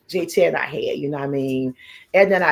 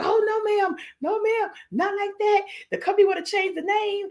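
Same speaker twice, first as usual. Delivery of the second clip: very high mock country-accent protest; imitated country accent. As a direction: put on a country man's accent, pitched way up. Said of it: "he country accent too".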